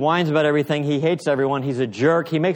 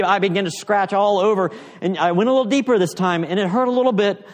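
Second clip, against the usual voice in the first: higher, louder, eager storytelling as Eustace; excited retelling; 6 semitones higher, 4 dB louder than usual. Retold or imitated. Preacher voicing Eustace, higher and louder with wonder. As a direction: Eustace marvels as his scales fall off. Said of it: "So he said".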